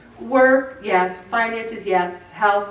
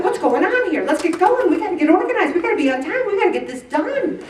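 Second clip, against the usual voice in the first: bossy organizer; 7 semitones higher, faster than usual. Retold or imitated. Speaker as busy Martha, higher brisk urging. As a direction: hurry everyone along. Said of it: "I'm like".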